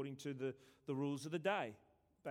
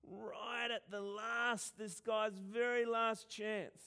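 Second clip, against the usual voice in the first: normal talking voice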